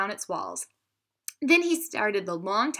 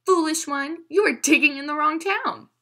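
loud, higher taunting shout; 7 semitones higher, 6 dB louder than usual. A narrator's reading. Madison shouting at the giant to lure him away. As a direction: call out across the dark to mock him. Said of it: "he shouted to him".

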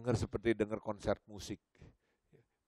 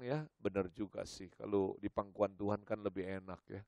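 soft inner thought; 4 semitones lower, 10 dB quieter than usual. Retold or imitated.

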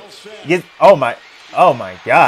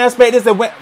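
ordinary delivery

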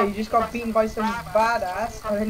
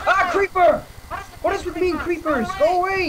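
startled yelp; 9 semitones higher than usual. Real, not acted. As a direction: jump out of your skin and shoo it away. Creeper suddenly appears in game.

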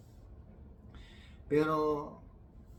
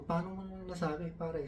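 1 high challenging question; 8 semitones higher than usual. Retold or imitated. Challenging friend's claim.

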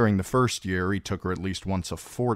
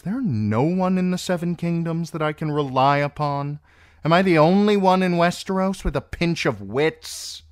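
high, loud, indignant tirade; exasperated rage; 11 semitones higher, 7 dB louder than usual. A narrator's reading. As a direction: rail at everyone's stupidity. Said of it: "whether to laugh or rage".

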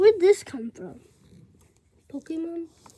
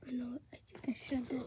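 whispering; 4 semitones lower, 15 dB quieter, faster than usual. Real, not acted. Hushed aside while sneaking.

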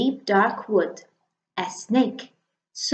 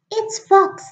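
much higher mouse, bright and quick; little mouse voice; 11 semitones higher, 6 dB louder than usual. Acted. The mouse announcing Snake, high little voice. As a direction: pitch it up like a tiny mouse.

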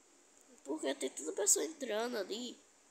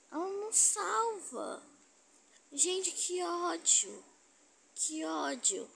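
slow, higher and louder whine; frustrated whine; 6 semitones higher, 3 dB louder, slower than usual. Real, not acted. Annoyed at a teammate who won't save, slow high whining. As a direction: drag out the frustration.

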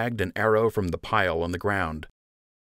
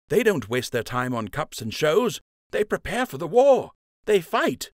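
high, heated voice rising at the end; insist, fed up with shows; frustrated young archer; 8 semitones higher than usual. Acted. Nate argues frustrated.

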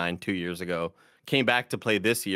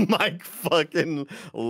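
laughing; 6 semitones higher, slower than usual. Real, not acted. Laughing while telling.

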